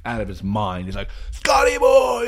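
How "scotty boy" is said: shouting. Retold or imitated. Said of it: "screaming my name".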